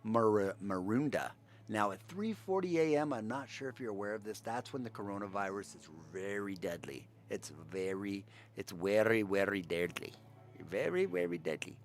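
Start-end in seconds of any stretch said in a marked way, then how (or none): 1.7-11.8 light put-on Indian accent, hushed; lightly mocking an Indian accent about covid. Podcaster mocking covid fear in a faint Indian accent. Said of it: "That's a terrible Indian accent".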